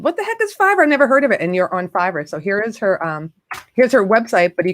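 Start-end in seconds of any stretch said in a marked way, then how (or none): 0.0-1.4 incredulous. Retold or imitated. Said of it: "And I said".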